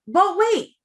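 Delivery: high, punchy sales pitch; salesman voice. Pretend. Host teasing viewers with extras, salesman voice. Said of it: "she said in her salesman voice".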